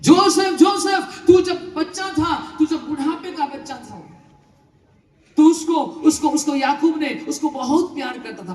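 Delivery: high, loud opening call; fervent preaching. Acted.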